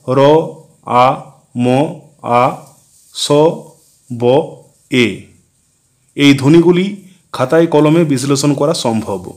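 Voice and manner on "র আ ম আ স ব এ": "র, আ, ম, আ, স, ব, এ" as separate sounds, a pause between them. Shown as a demonstration. Teacher splitting a sentence into its segmental sounds, sound by sound. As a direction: say each of "র, আ, ম, আ, স, ব, এ" on its own. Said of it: "এই বাক্যটির বিভাজ্য ধ্বনিগুলি হল".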